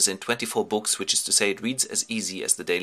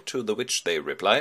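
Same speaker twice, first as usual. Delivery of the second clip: ordinary delivery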